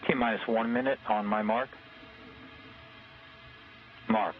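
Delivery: countdown crackling over a radio loop; mission control radio. Real, not acted. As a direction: call it like a launch controller on comms. Launch countdown over comm loop.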